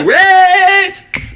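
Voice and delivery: very high, long drawn "Rich"; stretched flirty name call. Retold or imitated. Woman walking into the store, calling him high and long. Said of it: "She goes into the store like".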